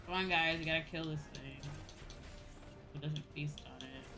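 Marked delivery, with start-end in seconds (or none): none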